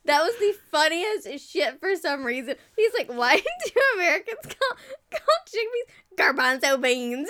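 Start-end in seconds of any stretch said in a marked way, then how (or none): 3.1-7.3 laughing imitation of his question; retold puzzled question. Retelling his question about American words, laughing imitation. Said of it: "Just the way he said it".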